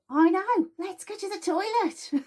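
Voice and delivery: very high, giggling plotting voice, laughing at the end; giggly sneaky body voice. Pretend. Bladder voiced as a giggly imp tempting the child to the toilet. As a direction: plot mischief like a giggling imp. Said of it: "the bits go".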